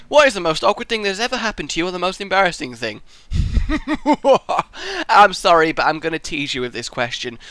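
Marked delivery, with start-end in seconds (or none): 3.3-5.0 high chuckle; laugh. Real, not acted. Reacting to an embarrassing question, high laugh. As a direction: let out a high, rising laugh.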